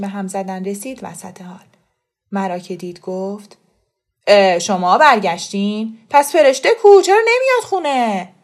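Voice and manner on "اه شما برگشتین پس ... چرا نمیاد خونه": raised voice, climbing high; loud demanding questions. Acted. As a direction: burst in demanding to know where she is. Khosrow storms in demanding Fereshteh.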